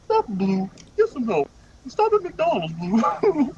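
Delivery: high cartoon meme voice; Wilt. Friend as Wilt telling Blue to stop, high voice. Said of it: "I think Wilt's obsessed with Blue's name".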